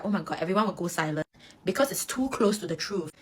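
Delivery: normal talking voice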